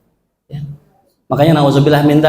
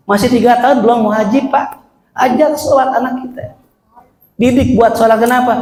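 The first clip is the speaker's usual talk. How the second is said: higher, insistent preaching; urging sermon; 8 semitones higher than usual. Acted. Preacher urging parents to teach prayer, higher. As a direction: press the parents to act.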